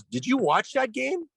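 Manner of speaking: high, prodding coach voice; imitating TK. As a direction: lean in with fake friendly questioning. TK grilling him, retold.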